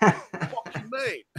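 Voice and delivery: high, loud mock Cockney fuck you mate; hammed-up Cockney threat; Cockney snarl. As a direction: snap it like a bar brawler from London. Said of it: "He's Cockney to hell".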